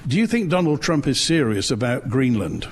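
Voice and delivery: usual speaking voice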